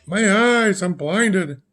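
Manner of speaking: put-on 2 high-pitched blinded boy's wail; 3 cry out as if your eyes burn; 4 a boy yelping that he can't see; 1 mock cry of pain.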